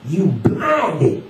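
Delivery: high, pointed accusation; old street sage admonishing; insistent homeless man. Acted. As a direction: jab the words at the listener. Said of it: "He spoke each word as though jabbing".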